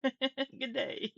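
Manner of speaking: laughing, badly faked Aussie greeting; fake Australian accent. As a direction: greet them in mock Australian through a laugh. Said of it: "fake Australian accent".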